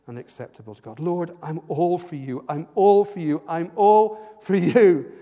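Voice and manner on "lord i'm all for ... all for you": building from loud to very loud, higher each time; passionate declaration. Acted. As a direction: swell each repeat into a cry of devotion.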